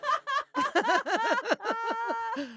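squealing, very high laughter. Real, not acted. Laughing fit continues.

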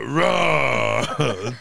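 long, loud mock roar; hammed-up monster yell; imitated haunted-house roar. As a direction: roar like a scare actor jumping out. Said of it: "He comes out".

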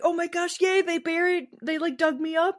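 high, gushing mock glee of a dug-up corpse; high giddy mock-excited voice. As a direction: squeal it like a thrilled corpse. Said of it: "you're probably like".

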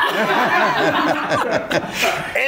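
short breathy laugh. Real, not acted. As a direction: chuckle through the line. Laughing at his own story. (risa breve)

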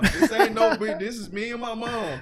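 laughing hard through the words, loud and high; cracking up while insisting it's true; big laughing outburst; laughing loudly. Real, not acted. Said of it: "Oh, God".

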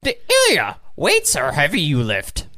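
put-on puppet sage voice, pitch leaping about; Yoda. Friend asked for an impression, teasing Ilya about weights, Yoda voice. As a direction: play an odd little creature, words in back-to-front order. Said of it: "Can you do a Yoda impression?".

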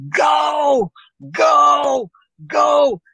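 panicked shout. Retold or imitated. Fleeing sergeant yelling in panic as the beast charges. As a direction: yell it in alarm, fleeing.

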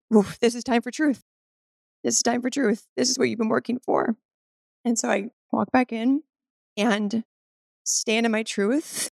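ordinary delivery

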